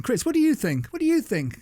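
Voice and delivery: high, calling through the studio microphone; over the talkback. Retold or imitated. [name] calling to [name] through the studio microphone, high. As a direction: call out to a dozing producer. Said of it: "Coming through the microphone".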